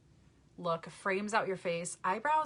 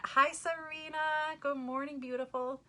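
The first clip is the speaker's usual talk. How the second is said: excited greeting; 9 semitones higher than usual. Real, not acted. Greeting a viewer by name, excited sing-song.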